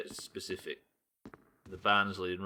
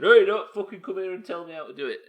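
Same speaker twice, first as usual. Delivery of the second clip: hammed-up angry snap, higher and louder; mock snapping; 10 semitones higher, 11 dB louder than usual. Streamer imitating himself snapping at a viewer: mock angry. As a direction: pretend to bark at a pushy viewer. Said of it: "sounded like I was immediately saying".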